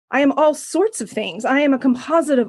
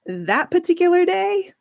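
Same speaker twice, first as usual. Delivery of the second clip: normal talking voice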